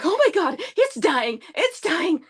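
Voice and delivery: high-pitched, hammed-up panic; retold freak-out. Driver watching the car die, retold high panic. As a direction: re-enact a frantic cry that jumps high. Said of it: "I was freaked out".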